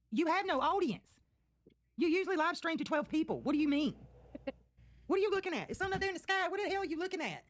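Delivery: high, mocking taunts at the screen; jeering streamer commentary, pitched well up. Real, not acted. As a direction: needle her from up high.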